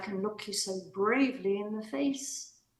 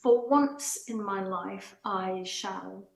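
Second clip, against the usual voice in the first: usual speaking voice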